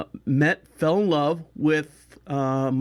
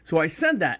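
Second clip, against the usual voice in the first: ordinary delivery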